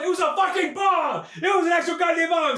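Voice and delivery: high, emphatic storytelling yell; incredulous shout. Retold or imitated. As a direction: shout the absurd punchline. Shouted punchline of bomb story.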